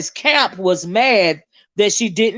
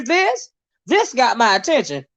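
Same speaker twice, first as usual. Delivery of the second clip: ordinary talk, nothing marked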